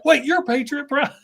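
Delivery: high, startled realisation; sudden recognition. Retold or imitated. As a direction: spin around and realise. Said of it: "he whips around".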